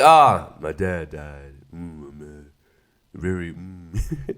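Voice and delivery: low, slow, barely-there mumble; mock the flat, dead-voiced lead actor; mumbling lead actor. Reviewer mocks the main actor, low mumbling. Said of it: "the main dude is just like".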